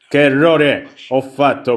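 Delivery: usual speaking voice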